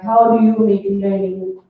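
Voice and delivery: ordinary delivery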